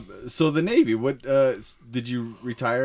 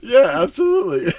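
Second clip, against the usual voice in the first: laughing; 6 dB louder than usual. Real, not acted. Laughing at awkward guests.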